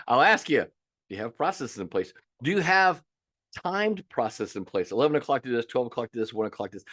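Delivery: usual speaking voice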